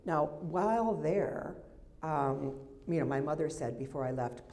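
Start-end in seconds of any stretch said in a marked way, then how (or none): none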